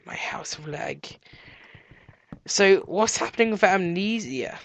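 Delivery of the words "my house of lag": hushed, whispered echo. Pretend. Player echoing about his house of lag, whispered. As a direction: whisper it to yourself. Same words repeated whispered.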